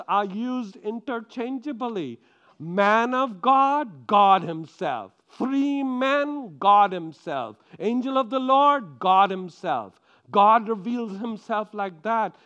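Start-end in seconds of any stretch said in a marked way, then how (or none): 2.6-10.0 loud, slow proclamation, each phrase hammered; preaching at full force. Acted. Preacher proclaiming God's names loudly to the congregation. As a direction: thunder out each title from the pulpit.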